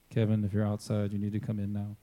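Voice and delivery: preacher calling a latecomer back in. Real, not acted. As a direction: summon Kevin back inside. Calling a member back inside.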